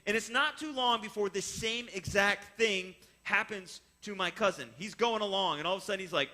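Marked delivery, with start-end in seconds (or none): none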